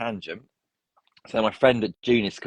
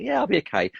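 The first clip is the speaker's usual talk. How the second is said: much higher, breezy mock-casual reply; falsetto reassurance; 11 semitones higher, faster than usual. Injured player tells the coach he is fine, much higher and breezy. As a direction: play it off lightly in a high voice.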